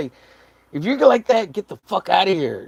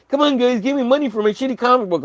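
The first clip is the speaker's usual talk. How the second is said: mock begging artist; 10 semitones higher, faster than usual. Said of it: "be like".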